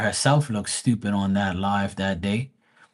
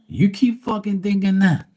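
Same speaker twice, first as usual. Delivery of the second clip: high-pitched, scoffing comeback; mocking retort; 10 semitones higher, 4 dB louder than usual. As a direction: throw it back at her in a much higher voice. Acted comeback to the lady.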